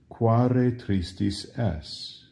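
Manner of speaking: slow, clear Latin; slow model answer. Shown as a demonstration. Teacher slowly giving the Latin reply. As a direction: lay out each word slowly. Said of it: "say it that way".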